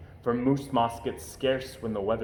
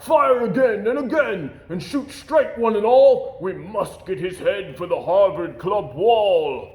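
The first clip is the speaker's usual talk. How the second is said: loud, high barked orders; rally the hunters to fire; loud, high commanding hunter; hunter calling orders; 6 semitones higher, 7 dB louder than usual. A narrator's reading. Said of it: "heard a voice call".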